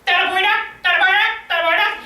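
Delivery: high, strained mock yell; imitated distant yelling. Man yelling from seventy meters away, high strained mock yell. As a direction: call it out as if from far off. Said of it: "He starts yelling at me".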